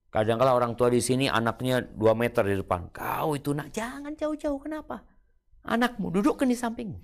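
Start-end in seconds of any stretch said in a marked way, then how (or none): 2.9-7.0 mock parent calling out. Parent calls to child from afar.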